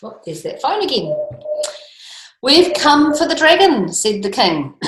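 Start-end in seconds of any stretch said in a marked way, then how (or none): 2.4-3.9 king's voice. A narrator's reading. King voiced in read story.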